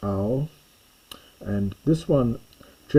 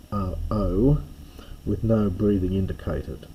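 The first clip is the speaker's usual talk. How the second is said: ordinary talk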